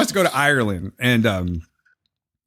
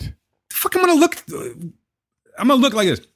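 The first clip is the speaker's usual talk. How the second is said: exasperated; 13 semitones higher than usual. Retold or imitated. Mocking bored tourist.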